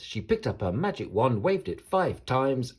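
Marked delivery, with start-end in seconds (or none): none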